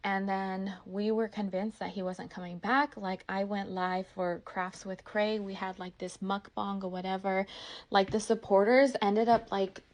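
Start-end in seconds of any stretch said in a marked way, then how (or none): none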